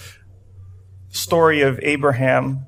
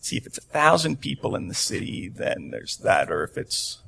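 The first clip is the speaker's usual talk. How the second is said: hushed, half-whispered counting; muttering to himself; 8 semitones lower, 11 dB quieter than usual. Retold or imitated. Preacher musing on Sodom's size, hushed and soft. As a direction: think the numbers out under the breath. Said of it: "And so I'm like".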